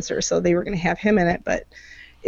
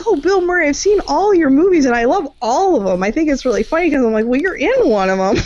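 excited fanboy; 8 semitones higher, 4 dB louder than usual. Retold or imitated. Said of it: "He was just like".

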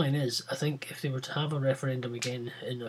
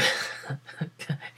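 silent shaking laugh; unvoiced giggle you can't stop; breathy chuckle; 3 dB quieter than usual. Real, not acted. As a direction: chuckle to yourself under the breath. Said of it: "I can't stop laughing".

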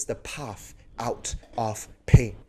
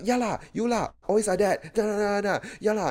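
high, nagging grumble at yourself; mock self-scolding; 7 semitones higher, faster than usual. Preacher showing how he berates himself, mock scolding. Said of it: "but scold myself".

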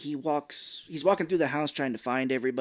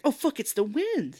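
high mock alarm; comic panicked actor imitation; Mark Wahlberg; 11 semitones higher than usual. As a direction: gasp it out in a silly high voice. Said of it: "he's like".

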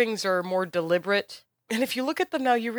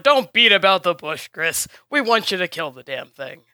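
much louder, bluff and hearty; boisterous man; 7 dB louder than usual. Acted. Boisterous man teases Chris at the table, loud and hearty. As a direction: slap her on the back and cut to the chase. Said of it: "the big boisterous man kinda smacks her on the shoulder".

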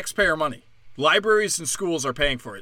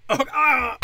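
mock surprise; 9 semitones higher, 4 dB louder than usual. Mock realization.

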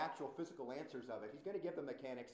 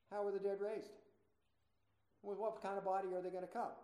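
higher-pitched, put-on mocking question; mocking inflection; 5 semitones higher than usual. Preacher voices doubters questioning the resurrection, mocking. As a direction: ask it as a scoffer would. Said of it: "that's kind of the inflection".

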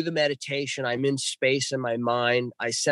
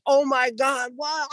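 amazed; 11 semitones higher, slower than usual. Retold or imitated. Seeing flashes in sky.

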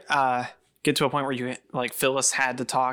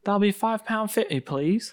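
higher-pitched British shop clerk; British accent; 8 semitones higher than usual. Acted. Acting out a money exchange in a British accent. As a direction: quote a price in polite mock British. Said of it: "went all British accent".

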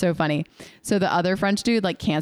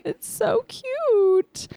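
gushing; much slower than usual. Real, not acted. Gushing over a sweet scene.

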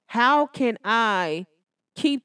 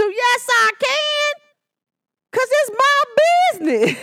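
defiant mom; 13 semitones higher, 5 dB louder than usual. Retold or imitated. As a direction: drag it out like a stubborn mom. Mom's defiant comeback.

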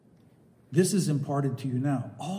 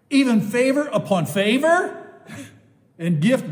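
fervent preaching; 6 semitones higher, 7 dB louder than usual. Real, not acted. Preacher rereading scripture to the congregation, fervent and loud. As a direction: proclaim it like a revival sermon.

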